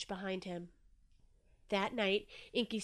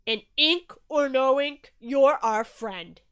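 slow, loud, emphatic declaration; weighty, hearty bellow; booming Shark; 4 semitones higher, 12 dB louder, slower than usual. A narrator's reading. Shark declaring friendship to Inky, booming.

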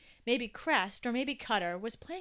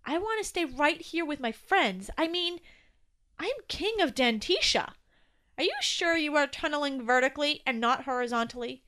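high, louder protest; pleading young Toby; 5 semitones higher, 6 dB louder than usual. Acted. Toby wanting to stay with friends, pleading. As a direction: argue to be allowed to stay.

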